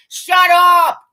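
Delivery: loud high yell of shut up; angry shout. Real, not acted. Fed up with players, shouting shut up. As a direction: blow up at them. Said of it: "ranting at jersey burners".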